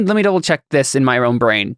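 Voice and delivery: normal talking voice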